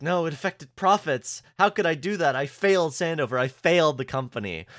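high, hammed-up mock panic; over-the-top fear. Host imitating Ian confessing he failed Sandover, mock over-the-top fear. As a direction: mimic a terrified office worker, overdoing the despair. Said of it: "extremely over-the-top fear".

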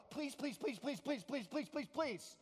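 rapid-fire string of begging; chanted, repetitive mock begging; mock pleading. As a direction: rattle off the pleas without thinking. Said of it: "We don't even think about it".

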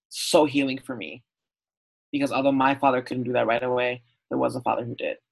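ordinary talk, nothing marked

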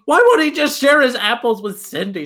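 mock word problem. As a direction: whine it like a silly textbook puzzle. Mocking math word problem.

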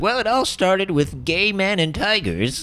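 slow, lightly voiced old grandpa. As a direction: draw it out like a storytelling grandpa.

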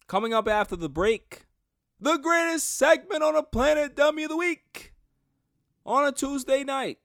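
high, booming show-host announcement; announcer voice. Acted. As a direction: hype it up like a TV announcer. Announcing segment after break.

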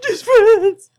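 squeaky high mocking voice; high mocking squeal. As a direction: squeal it, teasing.

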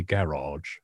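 garage with a long 'ah' in the second syllable, 'GA-rahzh'; posh garage. Retold or imitated. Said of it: "You're very posh".

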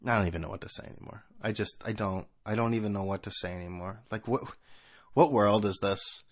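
low, soft, deflated disbelief; drained and speechless; at a loss. Pretend. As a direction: let it drop to a defeated near-murmur. At a loss after mock outrage.

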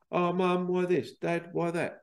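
a hint of a child's high, insistent questioning.

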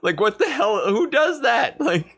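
high-pitched, baffled disbelief; real incredulity, pitched well up; incredulous. Real, not acted. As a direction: question it in rising exasperated disbelief. Scoffing at absurd film moment.

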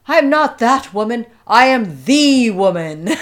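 put-on a diva roaring back in a heavy Russian accent; Russian accent shout. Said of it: "she shouts back with her Russian accent".

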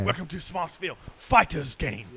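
game announcer voice. Pretend. Fake fight-game announcer.

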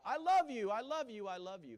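a loud cry that dies away with each repeat; stones crying out. Pretend. Stones crying out God's love.

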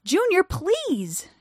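high, slow drawn-out begging; desperate plea. A narrator's reading. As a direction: plead with all you have.

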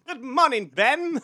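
high, bright 1920s wake-up call; sing-song old-timey "Good morning". Pretend. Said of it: "you're talking in that twenties voice".

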